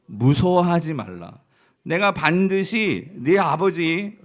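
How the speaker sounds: slow, loud, measured reading aloud; scripture reading. Acted. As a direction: read the verse slowly with the whole church. Congregation reads verse aloud together.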